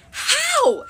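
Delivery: startled high-pitched yelp. A narrator's reading. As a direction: yelp it out, voice leaping way up. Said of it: "Cersei yelped".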